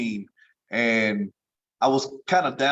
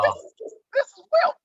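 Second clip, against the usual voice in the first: much higher and fast, stammering in awe; starstruck squeak; faster than usual. Retold or imitated. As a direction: tumble out the words, high and starstruck. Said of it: "You get the high tone".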